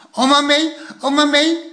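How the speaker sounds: put-on high, eager little-boy chant; small child singing out his wish; excited toddler. A narrator's reading. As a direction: bounce it like a tiny kid running round the table. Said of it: "zei het kleine kereltje".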